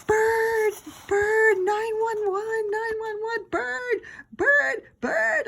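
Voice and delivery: shrill panicked screech at top pitch. A narrator's reading. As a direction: screech for help, wildly. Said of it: "I screeched".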